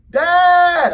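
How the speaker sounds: very loud, high yell; full-throated kid bellow; child's yell. Retold or imitated. Son refusing to budge, very loud high yell at dad. Son yells, won't move.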